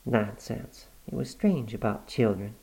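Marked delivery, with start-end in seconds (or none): none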